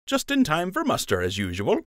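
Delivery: light smirking tease; smirking friend. A narrator's reading. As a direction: needle him with a grin. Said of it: "Lund said with a smirk".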